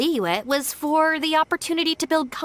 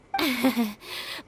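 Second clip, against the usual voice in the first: nervous laugh; 4 dB quieter than usual. Acted. Nervous laugh while excusing.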